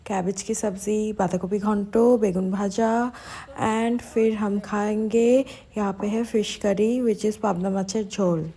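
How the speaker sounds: usual speaking voice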